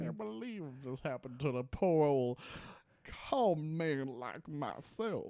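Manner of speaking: Southern con man sneering at his son; lightly hammed Southern accent; mock Southern drawl. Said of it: "That's how he sounded throughout the episode".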